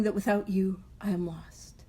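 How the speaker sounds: soft unvoiced whisper; whispered prayer. Real, not acted. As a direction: breathe the last line of the prayer. Ends the prayer softly.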